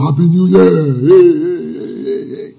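cheering that fades away; hammed-up festive shout, trailing off; mock celebration. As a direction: imitate a crowd cheering in the New Year. Acting out New Year celebration.

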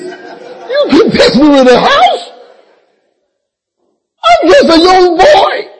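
hammed-up crying plea to God; half-laughing mock lament.

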